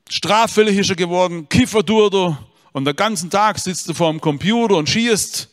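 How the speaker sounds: high, loud sing-song complaint; exasperated father half-singing his list; singsong lament. Retold or imitated. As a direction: chant the son's sins like a lament. Priest continues his litany.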